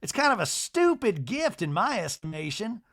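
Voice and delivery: high-pitched imitation of an affronted matriarch; Miss Patricia. Miss Patricia on the cane gift, high put-on disdain. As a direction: lift it high, mock-disdainful. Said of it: "Miss Patricia goes".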